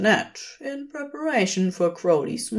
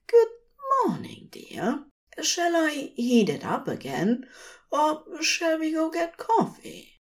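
higher, gentle fussy angel voice; fond angel; 10 semitones higher than usual. Acted. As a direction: coo it to your sleepy partner. Aziraphale voiced in story.

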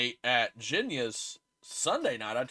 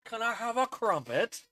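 mock British; 8 semitones higher than usual. Mocking the Brits.

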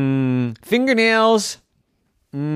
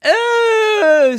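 very high, loud startled shout; shocked cry; 11 dB louder than usual. A narrator's reading. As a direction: yelp in disgusted surprise. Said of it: "said Huxley".

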